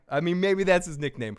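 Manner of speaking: normal talking voice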